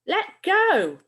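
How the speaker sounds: high, loud angry command; angry demand. Acted. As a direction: snap it out, losing patience. Bill demands comic back, fight brewing.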